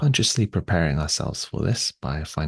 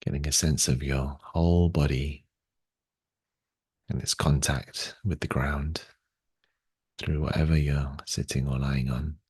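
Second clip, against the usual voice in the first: unhurried, slightly lowered meditation voice, long pauses; guided meditation. Real, not acted. Guides body awareness and breathing.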